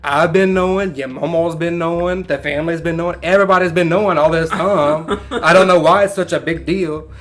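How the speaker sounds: a hint of a casual father who always knew; retold dad.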